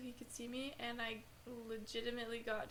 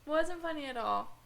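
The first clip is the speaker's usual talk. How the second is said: indignant; 5 semitones higher, 10 dB louder than usual. Real, not acted. Indignant retort.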